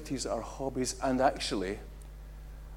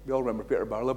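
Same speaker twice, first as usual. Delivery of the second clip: ordinary delivery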